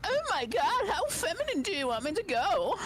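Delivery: very high-pitched feminine put-on voice. Shown as a demonstration. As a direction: go high and girlish, as a joke. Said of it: "Can you talk very feminine?".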